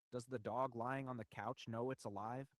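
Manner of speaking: normal talking voice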